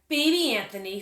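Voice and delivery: ordinary delivery, nothing marked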